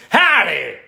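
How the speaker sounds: high, loud mock Texan holler; hammed-up Texan greeting, falling at the end; Texan accent. Streamer passing for a Texan, loud hammed-up twang. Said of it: "a Texan".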